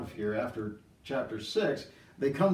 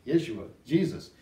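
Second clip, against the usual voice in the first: usual speaking voice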